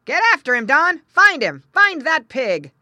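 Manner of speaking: loud, high calling to a dog; farmer's shouted command. A narrator's reading. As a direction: urge the dog on across the field. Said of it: "a man's voice saying".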